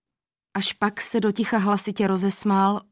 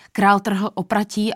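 usual speaking voice

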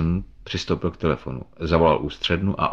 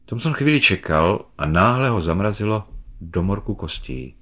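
usual speaking voice

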